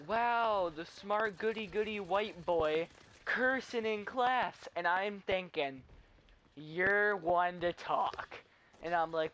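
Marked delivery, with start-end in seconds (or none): 0.1-4.7 high, loud, drawn-out wow; mock amazement. Classmate shocked the goody boy cursed, mock amazement. Mimicking an astonished classmate's remark. 6.6-8.4 louder, very slow comeback; indignant retort. Retold or imitated. Retorting to the hypocritical classmate, indignant retort. His indignant thought, retold.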